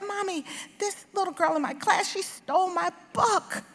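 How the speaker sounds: put-on high childish telling-on; a little girl telling her mommy, high and upset; child's voice, upset. Little girl to her mother about a stolen book, high and upset. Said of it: "And I said".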